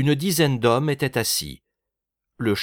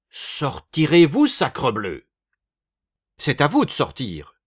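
angry boss; 3 semitones higher, 4 dB louder than usual. A narrator's reading. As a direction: bellow it at the intruders. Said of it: "hurla le chef de la bande".

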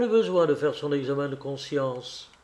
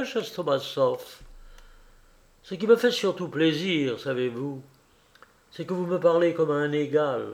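plain reading voice

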